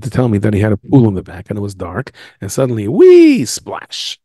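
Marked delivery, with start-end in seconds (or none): 2.9-3.4 falling whee. Acted. Falling into pool. 3.4-4.2 whispered splash. Acted. Sound effect of splash.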